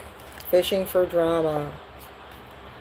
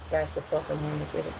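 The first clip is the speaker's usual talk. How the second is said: fast, much softer snitching aside; whispered tattle; 5 semitones lower, 11 dB quieter, faster than usual. Retold or imitated. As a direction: rat out the sibling under your breath. Sibling tattles on the culprit.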